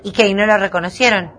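ordinary delivery

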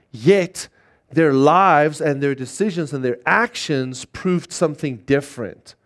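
ordinary talk, nothing marked

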